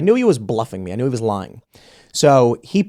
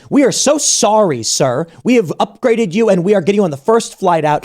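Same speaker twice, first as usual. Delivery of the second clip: fawning apology; 7 semitones higher, 4 dB louder than usual. Retold or imitated. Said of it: "I get a phone call".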